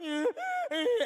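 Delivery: high laughing burst; burst of laughter. Real, not acted. Preacher laughing at the crying room. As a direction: laugh out loud at the memory.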